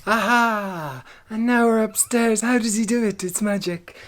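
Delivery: loud, high showman reveal; magic reveal. Acted. Vlogger revealing the jump to upstairs, showman style. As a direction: present it like a magician.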